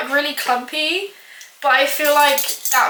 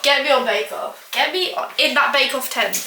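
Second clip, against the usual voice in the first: ordinary delivery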